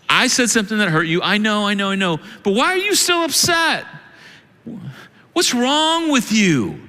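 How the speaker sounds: rising from hurried apology to a high, impatient what's wrong with you; a sorry that turns into blame; exasperated fake apology. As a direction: brush off the hurt, then snap back at them. Pastor acts exasperated apology.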